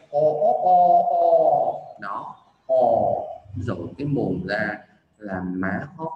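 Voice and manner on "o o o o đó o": loud, high "o" crows; rooster crowing. Shown as a demonstration. Teacher helping learners find the vowel, rooster crow. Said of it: "tưởng tượng mình là con gà trống".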